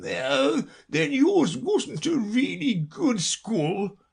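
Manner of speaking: slow, high sigh of relief; Mock Turtle. A narrator's reading. Mock Turtle dismisses Alice's school, greatly relieved. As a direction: let it out relieved, drawn out. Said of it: "in a tone of great relief".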